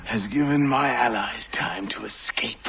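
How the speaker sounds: a fading, breathless whisper; dying whisper. Acted. Dying Templar gloats to his killer, whispering.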